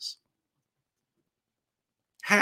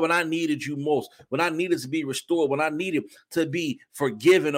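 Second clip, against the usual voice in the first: ordinary talk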